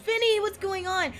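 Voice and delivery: high, loud hello to a viewer; called-out greeting. Real, not acted. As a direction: break off and call out, high and loud. Calling out to an arriving viewer.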